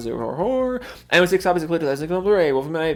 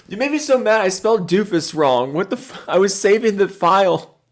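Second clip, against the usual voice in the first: ordinary delivery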